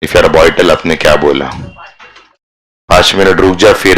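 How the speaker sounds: ordinary delivery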